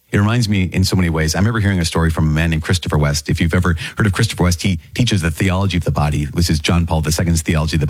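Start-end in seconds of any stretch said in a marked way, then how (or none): none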